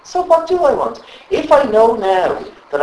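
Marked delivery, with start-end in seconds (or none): 0.0-1.0 eager self-questioning. Shown as a demonstration. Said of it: "And ask yourself".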